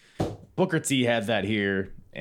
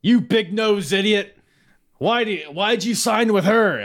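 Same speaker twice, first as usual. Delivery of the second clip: lightly voiced, angry Bischoff; Eric Bischoff; 10 semitones higher, 6 dB louder than usual. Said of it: "Eric Bischoff's like".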